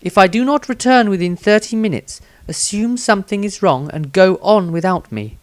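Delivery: a wizard setting a cautious plan. Acted. Wizard Maestro speaks.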